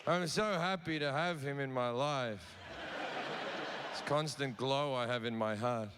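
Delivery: a hint of glowing contentment; mildly serene worshippers; blissful believers. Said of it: "everyone's like".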